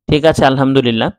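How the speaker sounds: ordinary delivery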